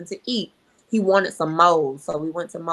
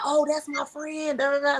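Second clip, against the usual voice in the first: higher-pitched retold excitement; imitating excited kid; 8 semitones higher than usual. Boy recognizing his friend's name to friend's mother, imitated excitement. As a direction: lightly act a boy bubbling over. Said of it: "he starts, like, kinda going in".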